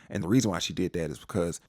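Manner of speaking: ordinary talk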